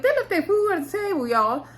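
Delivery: high-pitched playful disbelief; can't believe they did that; incredulous. Real, not acted. Mock outrage at wasted food.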